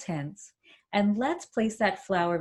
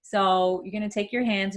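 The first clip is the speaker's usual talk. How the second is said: ordinary talk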